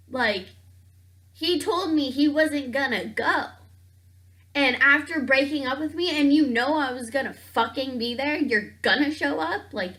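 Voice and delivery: outraged disbelief. Real, not acted. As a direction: vent the outrage at him showing up. Seeing her ex at the bar.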